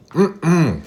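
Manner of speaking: high, loud pleased hum; savoring hum. Real, not acted. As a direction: hum like the food is great. Savoring the food again.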